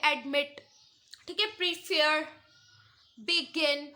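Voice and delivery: short first syllable, long second in admit, prepare, begin. Shown as a demonstration. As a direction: lean on मिट, fair and गिन. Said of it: "दूसरा syllable ज़्यादा long है".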